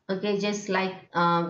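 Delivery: ordinary delivery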